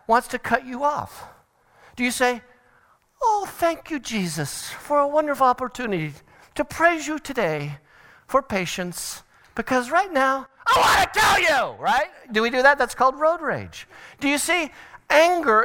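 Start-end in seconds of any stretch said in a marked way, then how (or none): 3.2-10.5 high, sweetly grateful praying driver; mock pious prayer. 10.7-11.8 high, strained furious yell; road-rage outburst. Acted. Road rage bursts out of prayer.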